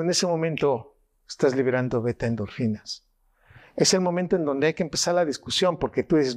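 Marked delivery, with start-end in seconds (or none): none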